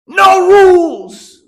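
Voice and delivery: high, drawn-out full-force scream; screamed slogan. Retold or imitated. Speaker imitates hippie lady running out, screaming. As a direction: scream it out long, pitch way up. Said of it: "and she screamed".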